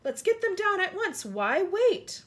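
high, loud rallying call; mighty shout. A narrator's reading. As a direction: call it out like an eager crowd. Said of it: "a mighty shout went up".

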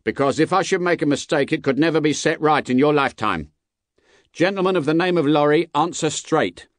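high, forceful warning call; guard calling out. A narrator's reading. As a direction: warn the stranger from afar, then demand an answer. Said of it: "the guard called to the voice".

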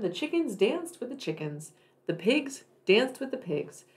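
plain reading voice

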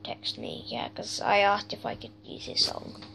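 hushed whisper. Real, not acted. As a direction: whisper it aside. Drops to whisper.

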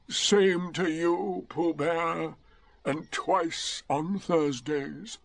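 slow, drawn-out doleful donkey; gloomy. Acted. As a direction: drag out the greeting gloomily. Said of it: "said Eeyore gloomily".

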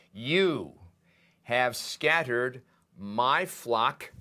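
"You" drawn out and set apart by a pause; stress on YOU. Shown as a demonstration. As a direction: lean hard on "You", then continue slowly. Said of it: "this is for emphasis".

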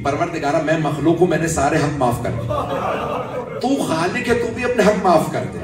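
loud, rising plea to God in full sermon force; a father begging God aloud, preached at full force; impassioned preaching. Acted. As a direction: cry out the prayer over the grave. Abu Dhar's prayer at son's grave.

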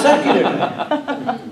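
high laugh spilling into words; amused laughter over a word. Real, not acted. Laughing after recitation.

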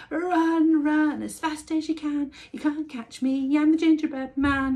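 high, loud teasing chant; shouting taunt. A narrator's reading. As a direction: shout the taunt over your shoulder. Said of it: "he shouted".

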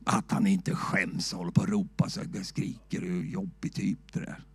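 soft, low, gossiping whisper; disapproving whisper. Retold or imitated. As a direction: whisper it behind your hand like a prim neighbour. Voicing a disapproving Swede.

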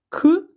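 К said as a crisp, high, loud k sound; a bright, sharp k sound. Shown as a demonstration. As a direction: pop out the К for the class. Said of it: "приголосний".

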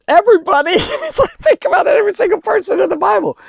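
laughing at his own joke to the congregation, pitch jumping way up. Real, not acted. Preacher to congregation, laughing high-pitched through the words.

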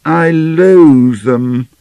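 loud, high, drawn-out declaration of loathing; loathing with conviction. A narrator's reading. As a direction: stretch it out slowly with full conviction. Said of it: "replied Lena with conviction".

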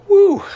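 sudden high whoop of amazement; loud woo. Real, not acted. Dad whoops, amazed his baby is one. Marvelling at daughter turning one.

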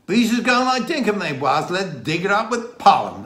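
high, loud, broad Dorset; mock broad Dorset accent; Dorset dialect. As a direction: imitate a Dorset villager announcing it in thick dialect. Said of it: "he said to me".

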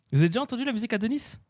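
ordinary delivery

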